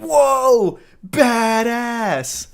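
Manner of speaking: high, loud hammed-up awe; mock amazement. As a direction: overdo the wowed exclamation. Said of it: "I love that with the exclamation point".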